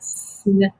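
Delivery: snake drawn out slowly, long A; sounded out. Shown as a demonstration. Teacher sounding out a word for pupils, snake said slowly.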